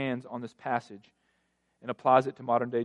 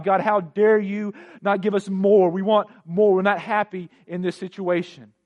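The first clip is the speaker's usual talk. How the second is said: indignant complaint; 7 semitones higher, 11 dB louder than usual. Retold or imitated. Preacher imitates Israelites complaining to God for more, indignant complaint. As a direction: imitate outraged demands, hammering them out loudly. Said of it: "they complain to God".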